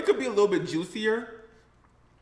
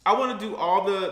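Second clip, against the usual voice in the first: ordinary talk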